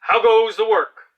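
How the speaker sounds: high, loud call from the lazy farmer; bossy farmer calling. Acted. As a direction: bellow it like a lazy boss from bed. Farmer voiced.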